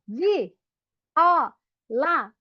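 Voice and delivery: "Vi, ó, lá" with a pause between beats; syllable by syllable. Shown as a demonstration. Teacher clapping out viola for children, syllable by syllable. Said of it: "Vamos bater palma?".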